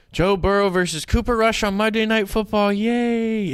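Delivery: high-pitched fake hype announcer; mock excitement. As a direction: cheer the matchup with put-on enthusiasm. Mock hype for a dull matchup.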